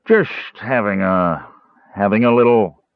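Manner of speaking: put-on sway through the words like a drunk; slurring, unsteady big man. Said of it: "Caramon swayed unsteadily".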